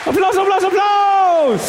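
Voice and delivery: high, loud hype shout; calling for applause. Acted. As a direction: whip up the crowd. Ruft Applaus aus. (Applaus einfordern, laut und hoch)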